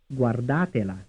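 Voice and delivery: "Guardatela" with the beat on DA; second-syllable stress. Shown as a demonstration. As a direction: lean on "da" in "Guardatela", then fall away. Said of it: "stressed on the second syllable".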